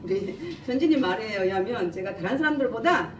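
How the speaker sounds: ordinary talk, nothing marked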